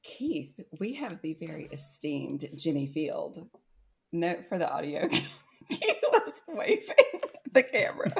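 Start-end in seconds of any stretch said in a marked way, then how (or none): 5.1-8.1 laughing. Real, not acted. Laughing aside to audio.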